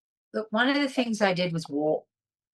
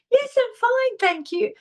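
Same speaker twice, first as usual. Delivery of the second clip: chirpy brush-off; 13 semitones higher than usual. Retold or imitated. Brushing off the stranger's concern.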